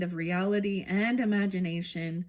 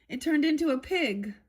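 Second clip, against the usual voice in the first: emphatic reveal; 6 semitones higher than usual. Acted. Alice answers the cat, reading.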